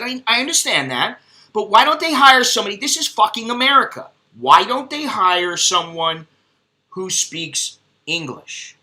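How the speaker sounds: hammed-up indignation at the counter; retold outrage. Telling the butcher lady off, outraged and loud. Retelling his outraged reply.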